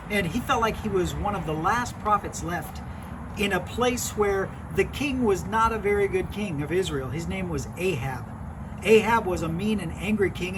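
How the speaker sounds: put-on animated storyteller voice for kids; lively Bible story narration. Youth pastor telling kids a Bible story, animated narration. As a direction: tell it like a children's pastor spinning a tale.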